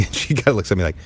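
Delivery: ordinary delivery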